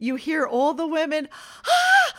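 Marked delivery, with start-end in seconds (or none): 1.5-2.1 high shocked gasp-cry; mock shocked cry. Mom imitating the party guests, a shocked high cry. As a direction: imitate the women gasping in shock. Women gasping at the fire.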